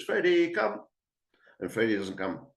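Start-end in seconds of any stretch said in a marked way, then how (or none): 0.0-0.8 imitated shout. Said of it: "And he shouts".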